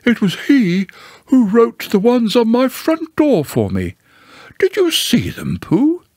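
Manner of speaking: high, swooping owl voice; Owl, self-important. Acted. Owl asks Pooh about his front-door notices, self-important.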